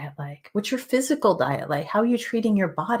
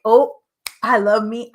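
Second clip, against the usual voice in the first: mock gushing; 7 dB louder than usual. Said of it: "And I'm not like".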